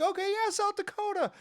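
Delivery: high-pitched mock announcer acknowledgement; imitated announcer. Announcer answering the South Dakota fan, imitated in a high voice. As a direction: put on a high host's voice.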